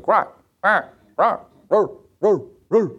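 high, short barking squawks; crow barking like a dog. Retold or imitated. Pastor imitating front-yard crows, barking like a dog. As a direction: imitate a crow's doggy bark, yap after yap. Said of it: "started barking like a dog".

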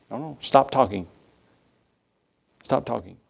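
calm a chatterer down softly; soft stop talking. Retold or imitated. Holy Spirit telling him to hush.

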